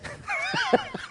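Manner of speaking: a sudden high squeak of a laugh; short high-pitched laugh. Real, not acted. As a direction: burst out laughing at the irony. Laugh after "exist.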